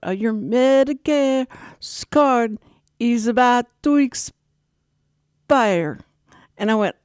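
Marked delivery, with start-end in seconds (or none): 0.1-6.0 drawn-out words in an imitated accent; slow right down, word by word, mimicking his accent; exaggeratedly slow mock caller, long pauses; talking slower. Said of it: "Could you just talk slower?".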